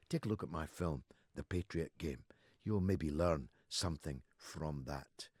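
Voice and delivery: ordinary delivery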